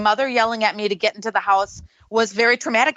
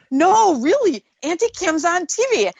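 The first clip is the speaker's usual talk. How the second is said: put-on insistent little boy; 7 semitones higher than usual. Nephew insisting to his mother, higher child voice. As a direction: play the excited three-year-old. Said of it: "my three-year-old nephew at the time".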